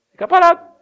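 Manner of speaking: shouted high, ringing answer; emphatic shout. Real, not acted. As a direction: call it out to the crowd. Pregador responde com ênfase. (resposta gritada com ênfase)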